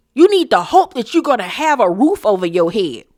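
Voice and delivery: normal talking voice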